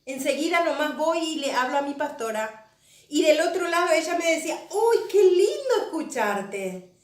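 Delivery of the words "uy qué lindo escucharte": slow, drawn-out delight at hearing someone; higher, slow, loving exclamation; imitating a loving greeting. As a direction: voice the pastor's affectionate welcome, lingering on the words. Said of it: "Con un amor como siempre".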